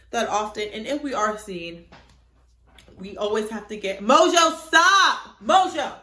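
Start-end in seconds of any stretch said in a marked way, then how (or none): 4.0-5.9 sharp, much louder, higher call; yelling at the cat. Real, not acted. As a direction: shout the cat's name to stop her. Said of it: "My cat was being annoying".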